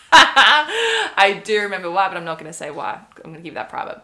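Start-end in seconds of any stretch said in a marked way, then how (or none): 0.1-2.1 laughing. Real, not acted. Laughing, suddenly remembering.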